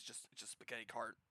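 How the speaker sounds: ordinary talk, nothing marked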